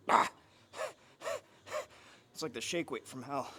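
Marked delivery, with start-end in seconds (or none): 0.1-0.3 loud short strained grunt; effort grunt. Real, not acted. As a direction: bark it out mid-lift. Lifting effort.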